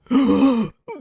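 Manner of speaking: high, sharp gasp; exaggerated scandalized gasp; mock horrified gasp. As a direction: gasp in pretend horror. Mock horror at slur mention.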